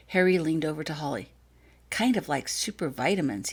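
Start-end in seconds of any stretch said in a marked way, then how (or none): none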